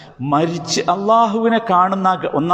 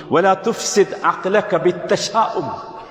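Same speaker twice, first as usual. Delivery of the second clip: slow Arabic recitation; 3 semitones higher, much slower than usual. Shown as a demonstration. Preacher quotes Arabic saying.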